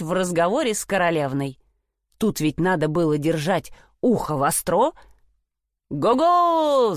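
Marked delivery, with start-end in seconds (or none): 5.9-6.9 high, drawn-out halloo; calling out. A narrator's reading. Simpleton hails his brothers on the road, calling out. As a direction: call after them from behind. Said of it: "закричал Ганс Чурбан".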